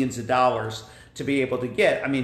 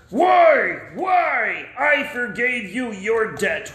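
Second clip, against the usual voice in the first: higher, loud angry why; angry king; 7 semitones higher, 4 dB louder, slower than usual. Acted. King confronts the unforgiving servant, loud angry why. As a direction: demand an answer in anger.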